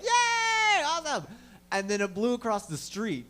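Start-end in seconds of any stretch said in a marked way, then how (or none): 0.0-1.3 high, loud squeal of delight; squeal of joy. Retold or imitated. Cheering when the wind saves their bubble, high squeal of joy. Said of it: "We're like".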